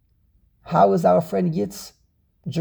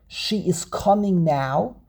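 ordinary delivery

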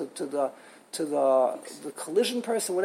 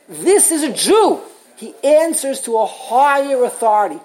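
emphatic declaration; 5 semitones higher, 7 dB louder than usual. Acted. Answering the onlooker emphatically.

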